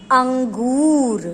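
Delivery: अंगूर said very slowly, the nasal ङ sound clear before ग; slow teaching pronunciation. Shown as a demonstration. Teacher to students, slowly sounding out अंगूर. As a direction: draw out अंगूर syllable by syllable so the nasal ङ is heard. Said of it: "जैसे कि".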